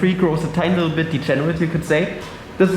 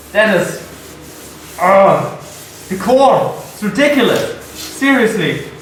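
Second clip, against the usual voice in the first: can't believe how good it looks; excited; 3 semitones higher than usual. Real, not acted. Amazed by curly core.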